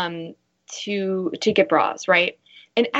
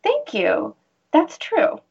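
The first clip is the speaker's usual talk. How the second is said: gracious thank you; 7 semitones higher, much slower than usual. Shown as a demonstration. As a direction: accept the compliment brightly and higher. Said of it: "I'll say something like".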